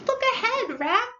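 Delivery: urgent, high-pitched cry of alarm; high, alarmed shout to a friend; sudden warning cry. A narrator's reading. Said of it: "cried the Mole suddenly".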